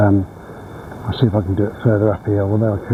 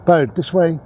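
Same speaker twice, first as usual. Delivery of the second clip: higher coaxing dog voice; praising the dog; 7 semitones higher than usual. Real, not acted. Owner praising her dog and steering him this way, high coaxing voice. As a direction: lift the voice to praise and guide him.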